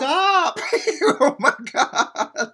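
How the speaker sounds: high, loud laughing protest; laughing shock. Real, not acted. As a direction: shriek through laughter. Reacting to explicit video.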